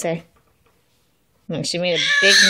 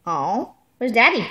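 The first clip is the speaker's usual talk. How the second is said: ordinary talk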